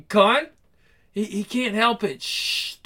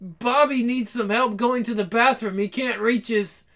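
normal talking voice

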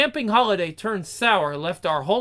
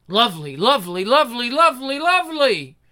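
building ecstasy; 3 semitones higher than usual. Acted. Ecstatic repeated cries.

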